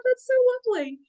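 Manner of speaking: very high, swooning gush; high fluttery delight; gushing admiration. Retold or imitated. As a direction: coo it like you're melting over it. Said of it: "we're just like".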